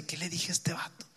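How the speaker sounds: whispered worried aside, unvoiced. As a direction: whisper it to yourself in a panic. Recalled panicked thought. (susurro preocupado para sí mismo)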